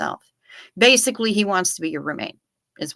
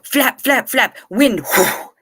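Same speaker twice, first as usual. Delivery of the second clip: higher, louder mock flapping, then a breathy whoo; flapping seagull noises; 5 semitones higher, 3 dB louder, slower than usual. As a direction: act out the bird's flapping and whoosh. Mimicking the seagull's failed takeoff.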